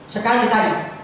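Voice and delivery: ordinary delivery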